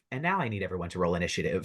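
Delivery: usual speaking voice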